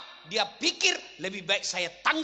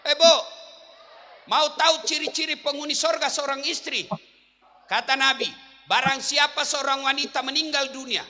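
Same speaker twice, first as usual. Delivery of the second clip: loud, high sermon voice urging the wives; forceful preaching; 9 semitones higher, 9 dB louder than usual. Acted. Preacher urging the wives in the congregation, forceful preaching. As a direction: preach it at full force to the mothers.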